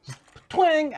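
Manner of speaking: loud, high imitated snap noise; twang sound. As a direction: shout the twang like a spring snapping. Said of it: "Wait for that snap noise".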